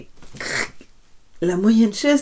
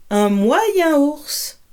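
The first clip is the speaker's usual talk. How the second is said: higher, slow middle bear; 7 semitones higher, 3 dB louder, much slower than usual. A narrator's reading. Bears from big to small.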